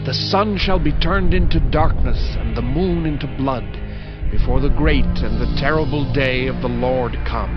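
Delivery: usual speaking voice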